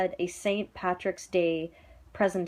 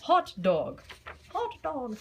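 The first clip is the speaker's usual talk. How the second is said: teacher drill; 3 semitones higher than usual. Shown as a demonstration. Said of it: "we're like".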